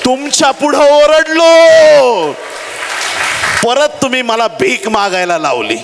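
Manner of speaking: high, loud, drawn-out cry of protest; acted outcry of a desperate beggar; anguished shout. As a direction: shout it out slowly, then ease down.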